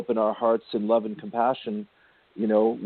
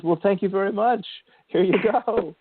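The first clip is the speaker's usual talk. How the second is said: higher, bright helpful guide voice; lightly voiced cheerful spirit guide, pitched up; 9 semitones higher than usual. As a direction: play a chirpy, obliging spirit handing it over. Spirit voiced cheerfully in retold story.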